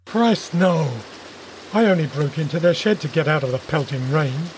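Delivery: low-voiced, indignant denial; offended. A narrator's reading. Intruder denying he hurt anyone, offended. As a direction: protest as if deeply offended. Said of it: "deeply offended".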